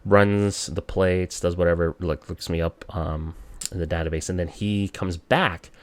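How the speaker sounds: normal talking voice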